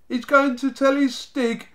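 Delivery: very high, loud jeer; high-pitched derisive gang cackle; cackling mockery. A narrator's reading. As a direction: hoot it back in ridicule. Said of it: "crackled the Snargets".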